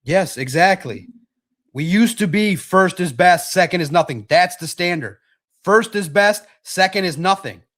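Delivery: loud, fired-up declaration; emphatic loud. Real, not acted. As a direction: shout the motto with conviction. Fired up about standard.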